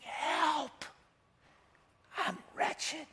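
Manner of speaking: soft, slow, high broken plea; desperate plea. Retold or imitated. Preacher voicing Paul's hushed desperate cry for help. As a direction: plead quietly like a drowning man. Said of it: "that man is a desperate man".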